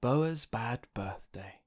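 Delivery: ordinary delivery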